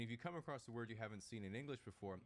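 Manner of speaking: ordinary delivery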